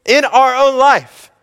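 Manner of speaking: high, loud full-force sermon delivery; fervent preaching. Acted. Pastor preaching his congregation toward Jesus, fervent and loud. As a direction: preach it out, driving every line home.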